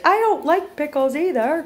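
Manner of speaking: high, put-on voice for the dog; dog's voice. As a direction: speak as the pet dog. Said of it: "Blueberry's like".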